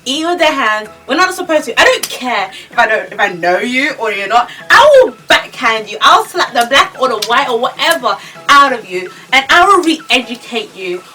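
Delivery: loud, high-pitched comic tirade; exasperated scolding. Acted. Vlogger telling off people who eat with hands, loud outraged rant. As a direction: lay down the law, loud and emphatic. Ranting at friend's table manners.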